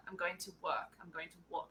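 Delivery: soft, work with a hard American R, walk with an open aw; American accent demo. Shown as a demonstration. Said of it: "That was American accent was very good".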